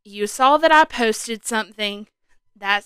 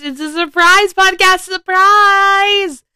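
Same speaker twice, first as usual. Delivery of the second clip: bright, loud, higher cry of surprise; excited announcement; 8 semitones higher, 7 dB louder, slower than usual. Real, not acted. Host opening her surprise podcast, excitedly shouting.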